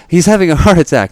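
high, loud mock alarm; panicked woman. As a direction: imitate a woman crying for help. Acted panicking woman in meme.

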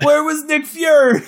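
high, loud, frantic shouting; hammed-up alarm, near yelling; mock panic. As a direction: shout it like the clock is running out. Retells her panic at time left.

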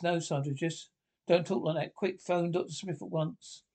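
ordinary delivery

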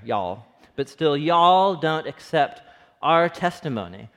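Texan twang leaning on y'all; Texan accent. Shown as a demonstration. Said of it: "this is a Texan, another Texan you right here".

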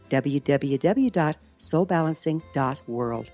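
ordinary talk, nothing marked

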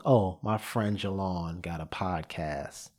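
mockingly underplay it like a favor to a buddy; half-hearted lazy recommendation; dismissive friend mention. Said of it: "don't tell us".

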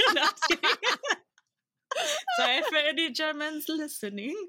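high-pitched, giggly backpedal; joking retraction through real laughter; laughing apology. Real, not acted. Joking, laughing at own quip.

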